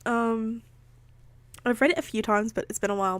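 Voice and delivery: ordinary delivery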